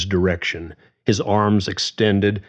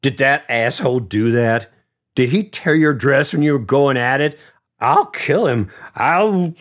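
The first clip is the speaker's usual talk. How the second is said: put-on drunk jealous rant; 7 semitones higher, 4 dB louder than usual. A narrator's reading. Drunk jealous James rants.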